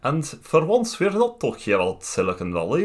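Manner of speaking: hammed-up Scottish voice with a choking, gargly edge; Scottish-Swedish mix. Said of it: "This is a, a Scottish guy who's got a bit of herring stuck".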